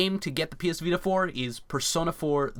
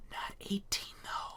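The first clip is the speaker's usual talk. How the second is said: hushed scandalised whisper; 15 dB quieter, slower than usual. Pretend. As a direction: whisper it like a secret. Mock scandalised aside.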